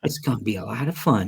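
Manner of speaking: a soft, lightly hushed vlogger imitation; quiet YouTuber voice. Mocking the travel vlogger's calm voice, lightly hushed. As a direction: lightly mimic the soft-spoken host. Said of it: "He talks like that".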